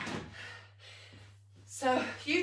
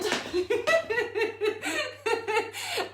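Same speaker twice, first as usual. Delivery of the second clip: breathless laugh; 9 semitones higher than usual. Real, not acted. Breathless laugh after drill.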